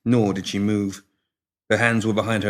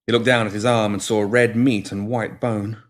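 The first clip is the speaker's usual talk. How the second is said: ordinary delivery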